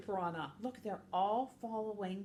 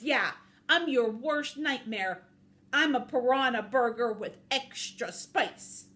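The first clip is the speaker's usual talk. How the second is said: loud, hammed-up boast; playfully menacing cartoon tough talk; mock menace; 4 semitones higher, 9 dB louder than usual. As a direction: punch out the threat with big swagger. Piranha boasts menacingly at guards.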